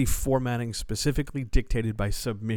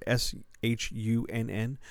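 usual speaking voice

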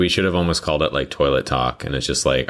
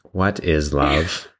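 slow robot voice; much slower than usual. Pretend. Plays robot discovering feelings.

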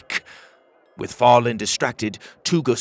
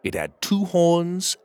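ordinary delivery, nothing marked

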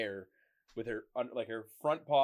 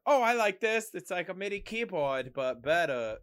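excited nerd voice; 8 semitones higher, 6 dB louder than usual. Retold or imitated. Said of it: "nerd part of me where I'm like".

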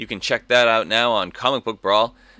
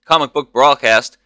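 booming showman announcer voice; ringmaster; 4 semitones higher, 6 dB louder than usual. Acted. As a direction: welcome the crowd like a circus ringmaster. Showman intro to podcast.

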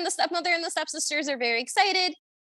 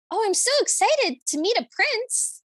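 higher, gushing mock excitement; eager girlish imitation, pitched up; excited Cinderella; 7 semitones higher than usual. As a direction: gush like a thrilled Cinderella. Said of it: "Cinderella's like".

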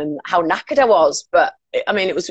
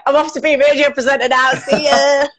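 excited; 7 semitones higher than usual. Retold or imitated. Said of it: "I was like".

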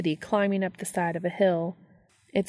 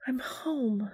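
whispered; 6 semitones higher, 6 dB quieter than usual. A narrator's reading. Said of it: "Lydia whispered".

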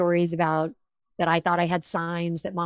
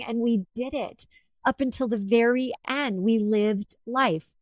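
usual speaking voice

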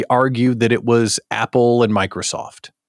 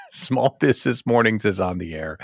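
show opener; 4 semitones lower than usual. Pretend. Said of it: "let's start the show".

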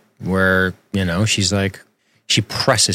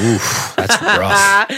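mock wince; 11 semitones higher, much slower than usual. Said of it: "you'd be like".

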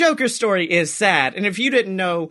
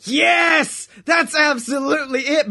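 excited shout; 7 semitones higher than usual. Real, not acted. Cohost cheering his partner's wig idea, excited shout. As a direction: yell it like the idea just clicked.

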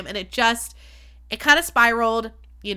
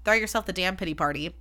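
usual speaking voice

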